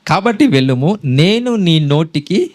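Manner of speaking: "నేను" leaned on with a slow rise, then "నీ నోటికి" drawn out; slow emphatic reading. Shown as a demonstration. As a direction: read it slowly so every word lands, weight on "నేను". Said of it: "ఇప్పుడు బాగా వినండి". ("నేను" పై ఒత్తిడితో నెమ్మదిగా చదవడం)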